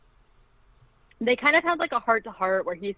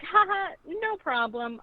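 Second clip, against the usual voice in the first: breezy fake laugh; 10 semitones higher, 3 dB quieter than usual. Quoting the unfazed girl.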